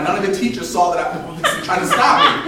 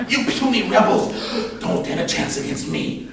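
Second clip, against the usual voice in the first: sinister breathy villain; 5 semitones higher than usual. Retold or imitated. Kid-villain threatening the charging classmates, sinister and breathy. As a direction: threaten the rebels like Darth Vader, slow. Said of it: "most sinister, breathy voice".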